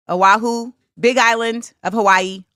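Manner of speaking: ordinary talk, nothing marked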